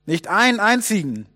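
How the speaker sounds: acted call from a boat; calling back. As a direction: shout it back across the water. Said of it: "rief sie zurück".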